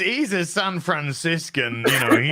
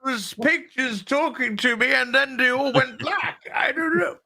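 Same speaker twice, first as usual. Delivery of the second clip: high, wailing mock English accent, baffled by technology; Gary, English accent; 9 semitones higher than usual. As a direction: put on a helpless, high-pitched English voice. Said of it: "Perfect Gary accent, that one".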